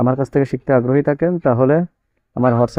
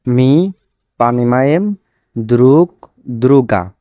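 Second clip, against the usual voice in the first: word by word demo; 3 dB louder than usual. Shown as a demonstration. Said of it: "এখানে এই যে দ্রুগ".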